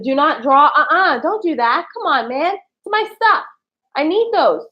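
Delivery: high-pitched, insistent pleading; pleading protest. Real, not acted. Telling someone not to mess with her stuff, pleading protest. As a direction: plead with them to leave your stuff alone.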